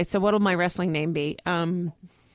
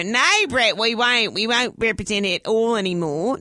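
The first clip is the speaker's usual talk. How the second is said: eager, placating Australian managers; raised, emphatic mock Australian accent; 2 semitones higher, 4 dB louder than usual. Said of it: "they were Australian".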